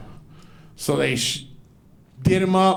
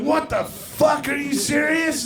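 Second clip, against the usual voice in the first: high, stretched-out incredulous cry; hammed-up astonishment; retold outraged disbelief; 10 semitones higher than usual. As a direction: exclaim it high in acted shock. Repeated shocked reaction, retold.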